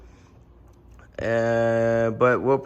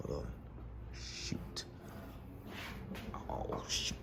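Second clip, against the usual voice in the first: whispering; 18 dB quieter than usual. Real, not acted. Can't find wood to knock.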